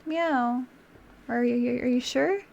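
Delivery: ordinary talk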